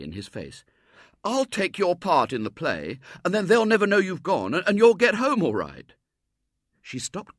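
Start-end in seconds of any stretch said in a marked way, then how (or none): 1.2-5.9 high, loud, eager schoolboy; excited boy. A narrator's reading. Boy offering to take her part, excited and loud. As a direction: rush out a brilliant idea.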